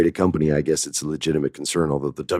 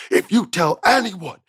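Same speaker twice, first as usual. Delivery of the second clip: high-pitched, panicked mock threat; frantic warning. Writer who confessed warns the others, frantic high voice. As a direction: squeal it like a man begging for secrecy.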